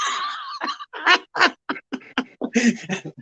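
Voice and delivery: real howl of laughter. Real, not acted. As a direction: burst out laughing at the punchline. Laughing after punchline.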